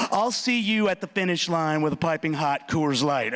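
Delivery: ordinary talk, nothing marked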